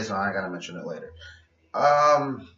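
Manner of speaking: normal talking voice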